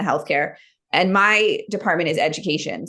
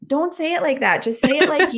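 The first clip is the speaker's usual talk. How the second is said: higher, pleading mock scold; pleading with husband; 9 semitones higher than usual. Wife teasing her Australian husband, higher and pleading. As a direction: beg him sweetly to sound like himself.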